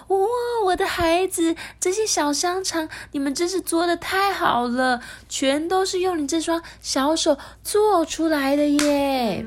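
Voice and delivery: high gushing praise, slowed at the end; doting queen mother. Acted. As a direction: coo over the child's work like a proud mother. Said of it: "结果皇后也说".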